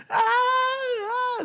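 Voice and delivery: imitated high, frightened crying shout; retold frightened cries. Retelling how the family screamed in fright, high crying shout. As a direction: mimic the terrified cries heard from downstairs. Family yelling upstairs at night.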